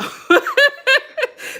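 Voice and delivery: high bright laugh breaking out; burst of laughter. Real, not acted. Speaker laughing at her own Philly-Chicago mix, real laughter. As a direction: laugh it off at yourself.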